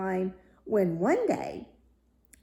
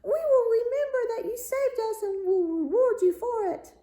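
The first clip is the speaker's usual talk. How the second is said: much higher, louder grateful fish voices; fishes crying out; 5 dB louder than usual. A narrator's reading. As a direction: call out joyfully from the water. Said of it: "and cried".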